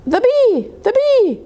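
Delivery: high, eager cub calling out; little cub thrilled to spot the bee; excited child bear. Acted. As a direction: point and squeal at the bee. Small Bear excitedly points out the bee.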